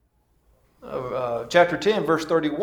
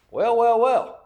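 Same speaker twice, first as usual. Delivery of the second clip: knowing well well well; 6 semitones higher than usual. Real, not acted. Amused at providence in story.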